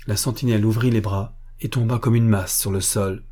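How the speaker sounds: ordinary delivery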